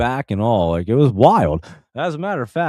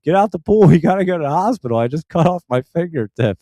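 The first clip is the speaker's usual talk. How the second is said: high, loud urgent call; retold yelling; 8 semitones higher, 4 dB louder than usual. Man yelling to his wife in the pool, urgent shout. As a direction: call out urgently, re-enacting the yell. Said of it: "I'm yelling to my wife".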